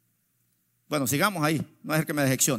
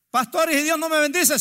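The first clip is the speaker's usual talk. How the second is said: congregante que pregunta; 10 semitones higher, 5 dB louder than usual. Retold or imitated. Voices a congregant's worried question.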